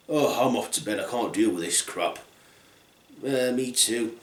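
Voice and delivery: low, soft, weary grumble; fed up. Acted. Bears off to bed after the mess, fed up.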